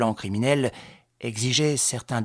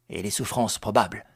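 usual speaking voice

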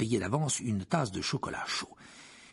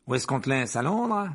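loud, cheery bus conductor; Stan's cheery voice; 3 semitones higher, 5 dB louder than usual. Acted. Stan the conductor greets his passenger, cheery. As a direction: call out brightly to the passenger. Stan asks where to drop Harry.

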